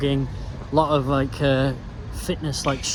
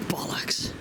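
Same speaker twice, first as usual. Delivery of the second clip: sigh, then whisper the curse; 7 dB quieter than usual. Real, not acted. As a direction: breathe the swear word out under your breath. Closed bridge, muttered curse alone.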